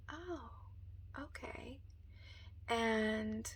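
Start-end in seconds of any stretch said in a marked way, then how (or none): 0.1-1.8 whispered surprise. Retold or imitated. Reacting to angel message.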